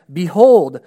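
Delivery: loud, ringing proclamation from the throne. A narrator's reading. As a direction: proclaim it loudly, falling at the end. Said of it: "I heard a loud voice from the throne".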